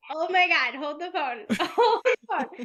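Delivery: mock alarm, the last words laughed; shocked reaction. Seller retelling her shock at a one-star review, laughing. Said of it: "and I was like".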